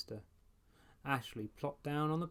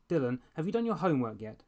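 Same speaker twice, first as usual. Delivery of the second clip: much louder, fast bossy call; 4 semitones higher, 8 dB louder, faster than usual. A narrator's reading. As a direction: call down to the little brother. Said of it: "he called down".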